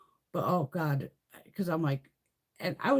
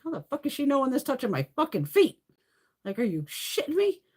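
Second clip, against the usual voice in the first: disbelief; 7 semitones higher than usual. Retold or imitated. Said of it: "I'm like".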